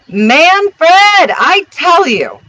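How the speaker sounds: loud, high bawl, insistent. A narrator's reading. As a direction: bawl it out to settle an argument. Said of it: "Here bawled out Miss Bob Lou".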